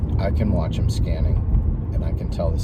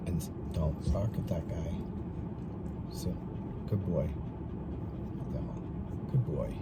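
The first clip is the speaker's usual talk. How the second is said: much softer, settled voice to the dog; calm soothing tone; 16 dB quieter than usual. Real, not acted. Trainer calming his dog near other dogs, soft and calm. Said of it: "a calm tone that's balancing".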